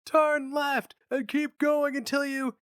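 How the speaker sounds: high, slow shout over clanging machines; shouting over noise. A narrator's reading. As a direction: yell directions over the din.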